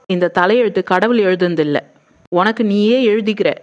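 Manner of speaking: usual speaking voice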